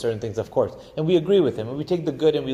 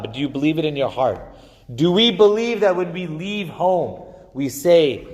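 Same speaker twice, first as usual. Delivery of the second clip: ordinary talk, nothing marked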